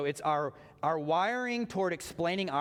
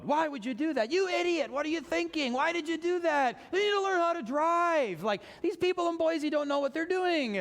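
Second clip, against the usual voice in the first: high, loud exasperated yelling at other drivers; road rage rant; 13 semitones higher, 4 dB louder than usual. Retold or imitated. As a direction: blast the bad drivers like you're behind the wheel. Ranting at drivers while driving.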